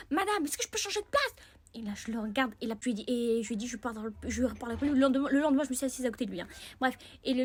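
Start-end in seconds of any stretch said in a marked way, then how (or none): 0.1-1.3 higher, lightly voiced boy; boy asking teacher. Boy asking the teacher to move seats. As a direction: ask the teacher politely. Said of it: "il dit à la prof".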